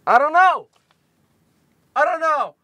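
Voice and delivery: high, loud exasperated yell; frustrated shout. Real, not acted. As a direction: throw your hands up and yell it. Frustrated outburst while drawing.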